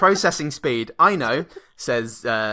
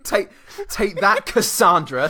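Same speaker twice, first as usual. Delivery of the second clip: mock movie villain; 7 semitones higher, slower than usual.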